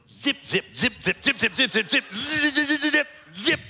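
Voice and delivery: high, rapid-fire imitated zipping; frantic buzzy zip sounds; zipper noises. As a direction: mimic a zipper yanked up and down, climbing in pitch. Mimics repeated zipper yanking.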